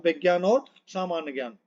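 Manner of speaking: ordinary talk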